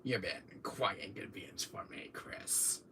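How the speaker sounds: whispered smug villain; Wesker impression. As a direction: menace him in a hush. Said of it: "that Wesker thing".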